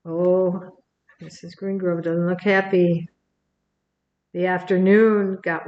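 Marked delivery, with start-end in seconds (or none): none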